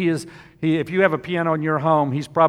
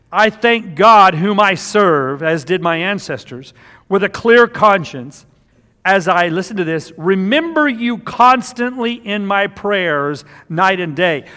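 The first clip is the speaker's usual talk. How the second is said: slow, louder pulpit reading; fervent scripture reading; 5 semitones higher, 7 dB louder, slower than usual. Acted. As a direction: read the verse like you mean every word. Reads scripture aloud with fervour.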